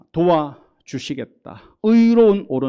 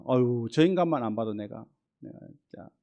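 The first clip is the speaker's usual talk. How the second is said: exasperated sigh; 3 semitones lower, 7 dB quieter than usual. Retold or imitated. Voicing exasperation at someone.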